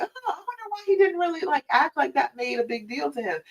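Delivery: high, quick, soft puzzled musing; wondering girlfriend. Retold or imitated. Woman puzzling over her partner's shrug at loss, high and quick. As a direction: voice her baffled inner thought. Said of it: "and you thought that".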